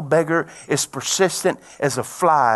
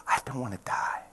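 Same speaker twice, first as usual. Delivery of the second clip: imitated tearful breakdown; 2 semitones lower, 10 dB quieter than usual. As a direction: whisper it as a broken-down patient. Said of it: "she started crying. She broke down".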